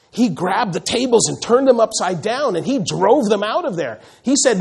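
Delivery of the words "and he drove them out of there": normal talking voice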